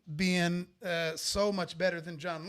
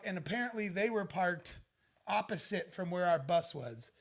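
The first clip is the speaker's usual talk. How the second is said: plain reading voice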